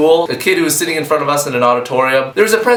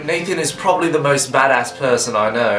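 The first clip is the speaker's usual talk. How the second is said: light put-on English accent; a touch of posh English accent throughout. Answering fan questions in a mock English accent. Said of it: "try and do a video entirely with an English accent".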